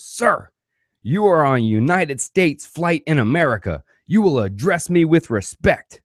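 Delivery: high, pompous scolding stewardess; high mock-stern stewardess voice. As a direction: lecture him like a self-important flight attendant.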